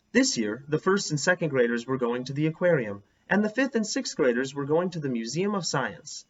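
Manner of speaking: lively read-aloud with character voices; reading to children. Acted. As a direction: read it like a story for the class.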